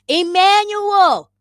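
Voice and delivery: high drawn-out call. Real, not acted. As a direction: call his name across the room. Calling out name.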